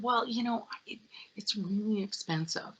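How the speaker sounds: soft unvoiced whisper of a warning. Warning the young client about the faucet price, whispered. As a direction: hush it like a discreet caution.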